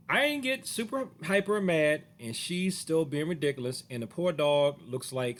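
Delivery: ordinary talk, nothing marked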